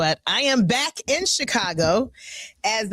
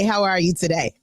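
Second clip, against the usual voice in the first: ordinary talk, nothing marked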